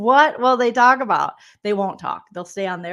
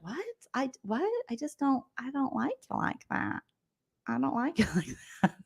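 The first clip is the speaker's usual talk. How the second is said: much softer, hesitant, higher mock-awkward voice; awkward rejection; 5 semitones higher, 11 dB quieter than usual. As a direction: let the guy down in a small embarrassed voice. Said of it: "And you're like".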